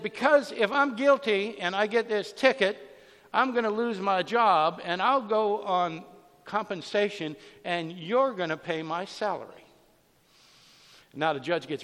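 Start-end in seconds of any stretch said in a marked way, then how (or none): 0.0-9.6 louder, higher, emphatic retort; retold indignant arguing. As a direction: act out her arguing back at the judge. Woman argues back at judge.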